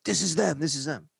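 high, pushy insistence; volatile cop. Retold or imitated.

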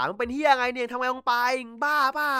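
half-acted shouted scolding; retold yelling at him. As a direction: scold him like a friend gone mad. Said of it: "ชิโฮะก็ตะโกนด่า".

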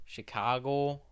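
ordinary talk, nothing marked

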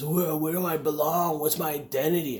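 lightly voiced angsty teen, pitched a bit up; mock angsty teenager. Mocking teenagers who wonder where they belong, angsty. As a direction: mimic a teen's identity crisis, drawn into a whine. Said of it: "not like those angsty teenagers".

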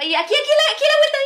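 high, loud urgent yelp; frantic warning. Retold or imitated. Passenger calling the turn being missed, frantic mock cry. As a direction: imitate a panicked back-seat navigator.

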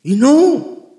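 loud, high, impassioned sermon voice, stretching the sad lines slowly; fervent preaching. Acted. Pastor exhorting the congregation to sing again, full-force preaching. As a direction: cry it out to the congregation like a revival preacher.

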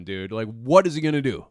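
ordinary talk

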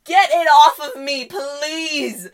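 loud, high, drawn-out plea; frantic begging. Retold or imitated. Daughter to mom who is taking a picture, frantically begging. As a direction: re-enact desperate begging, each word stretched. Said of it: "I'm begging you".

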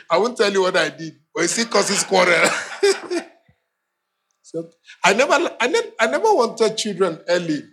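usual speaking voice

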